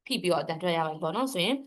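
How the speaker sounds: ordinary delivery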